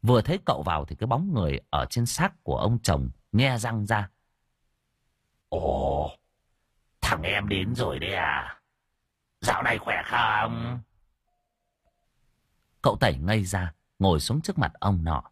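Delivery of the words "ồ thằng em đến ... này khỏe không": slow drawn-out ghostly greeting; possessing ghost. A narrator's reading. A ghost greets the master through a possessed man, eerie drawl.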